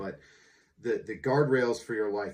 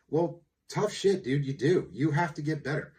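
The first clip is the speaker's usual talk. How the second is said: no-nonsense rebuke, raised; blunt tough love; 7 semitones higher, 5 dB louder than usual. Acted. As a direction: tell him straight, no sympathy. Blunt answer to his own protest.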